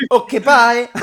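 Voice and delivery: high, loud, through laughter; giggling goodbye; laughing. Retold or imitated. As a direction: cackle it out. Laughing mock reply.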